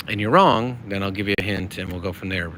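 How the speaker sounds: ordinary talk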